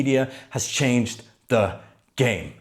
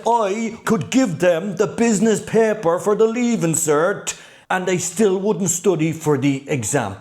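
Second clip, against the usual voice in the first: put-on higher, run-on Cork accent, passionate; imitating a Cork teacher's accent with passion; thick Cork accent; 6 semitones higher than usual. Business teacher complaining about students, thick Cork accent. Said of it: "to quote him in his thick Cork accent".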